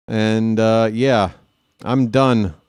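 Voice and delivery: normal talking voice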